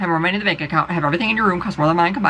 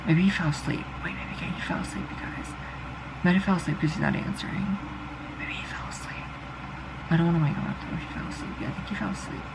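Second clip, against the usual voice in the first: hushed musing; 9 dB quieter than usual. Real, not acted. Speaker wondering if their man fell asleep, hushed. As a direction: drop to a near whisper, thinking aloud.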